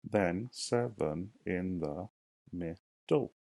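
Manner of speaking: drawn out, syllable by syllable; slow syllable count. Shown as a demonstration. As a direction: count it out slowly. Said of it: "teacher counts syllables aloud".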